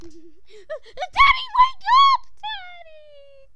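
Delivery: high, loud cry to wake Dad; panicked shouting. Acted. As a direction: scream for your father in terror. Frightened child wakes father.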